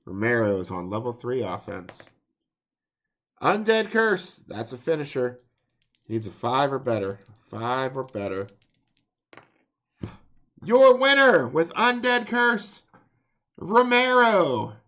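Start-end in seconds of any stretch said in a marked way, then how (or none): 3.4-4.4 dramatic callout. Acted. Announcing a finisher move. 10.6-14.7 loud, slow, high announcer call; ring announcer shout. Acted. Announcing the match winner.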